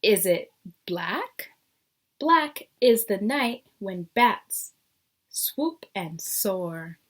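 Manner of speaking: lively storybook reading with rising questions; teacher reading a picture book to the class; reading to children. Acted. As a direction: read each guess like a curious cat. Reads the picture book aloud.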